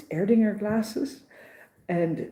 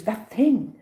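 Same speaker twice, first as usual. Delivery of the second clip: higher, louder retold jab; scornful emphasis, louder; rude woman; 5 semitones higher, 6 dB louder than usual. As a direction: imitate a rude complainer, hitting it hard. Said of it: "she's so rude to me".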